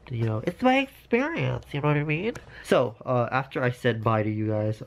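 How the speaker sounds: ordinary delivery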